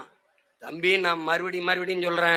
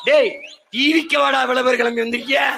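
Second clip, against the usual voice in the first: higher, exasperated call-out; mock scolding shout; 5 semitones higher, 4 dB louder than usual. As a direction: tell off the chanting fans loudly. Acted rebuke of chanting fans. (கிண்டலான உரத்த அதட்டல்)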